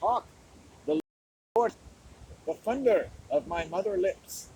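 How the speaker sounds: slow, grand archaic recitation with long pauses; lightly hammed-up solemn verse; mock poem recital. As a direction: declaim like a bard in a mock-heroic poem.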